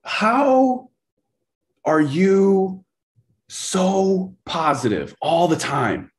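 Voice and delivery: very high, slow, halting imitation; timid questioner. Coach imitating a scared young rep asking him, timid and halting. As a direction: inch the big question out word by word. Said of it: "so scared he wasn't gonna ask his question".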